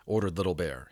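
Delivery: ordinary delivery, nothing marked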